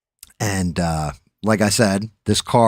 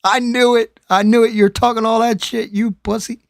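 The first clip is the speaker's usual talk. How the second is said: very high, loud falsetto jeer; mock taunting; 5 dB louder than usual. Friend mimicking the jeer at a big talker, high mock taunt. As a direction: squeal the taunt in a put-on high voice.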